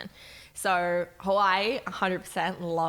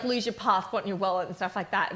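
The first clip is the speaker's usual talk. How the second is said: ordinary talk, nothing marked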